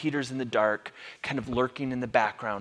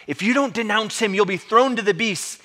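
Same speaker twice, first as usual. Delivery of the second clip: raised, loud threat; threatening; 8 semitones higher, 7 dB louder than usual. Acted. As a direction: threaten him as an angry Roman official. Acting the proconsul's threat.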